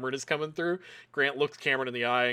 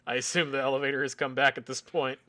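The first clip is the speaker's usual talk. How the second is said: normal talking voice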